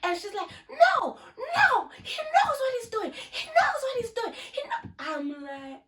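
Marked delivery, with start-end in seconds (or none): 0.7-4.9 put-on frantic girl pleading. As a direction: squeal it like the drunk girl begging. Girl pleads as she is removed.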